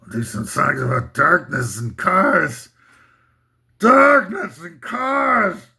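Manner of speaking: gruff rock-singer voice rising to a slow belted howl. Retold or imitated. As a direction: drag out darkness and cars like a stadium anthem. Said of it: "my [name] imitation".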